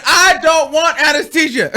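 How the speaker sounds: high, yelled protest breaking into laughter; hammed-up defiant yell; loud mock refusal. Retells refusing anesthesia, laughing.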